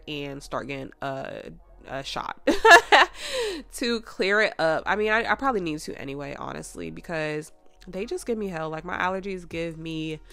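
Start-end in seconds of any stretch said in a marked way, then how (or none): none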